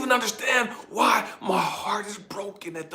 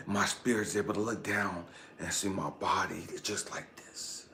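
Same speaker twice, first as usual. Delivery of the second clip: low, very soft narration; hushed storytelling; 12 semitones lower, 13 dB quieter than usual. A narrator's reading. Telling how a spirit enters the tent, hushed. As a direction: draw listeners in with a near-whispered hush.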